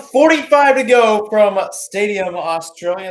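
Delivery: loud, slow, booming broadcast open; big announcer opening. Acted. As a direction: boom it out like kicking off a live broadcast. Opening call of second half.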